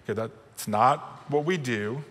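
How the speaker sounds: ordinary talk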